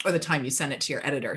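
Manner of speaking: ordinary delivery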